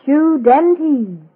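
drawn-out slogan with a dramatic gasp; slow ad slogan. Acted. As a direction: say it at half speed, gasping before breathtaking. Closing slogan of gum advert.

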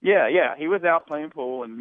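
ordinary talk

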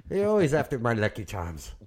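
high, hammed-up leprechaun lilt; mock Irish brogue. Joking back to his co-host in a high mock Irish leprechaun voice. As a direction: play a cartoon leprechaun.

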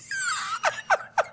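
high-pitched burst of laughter; real, squealing laugh. Real, not acted. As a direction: crack up helplessly. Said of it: "I'm laughing, but".

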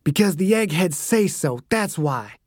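irritated, tight-jawed retort; curt annoyance, teeth clenched; through gritted teeth. A narrator's reading. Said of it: "Dew ground his teeth".

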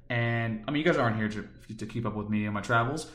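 ordinary talk

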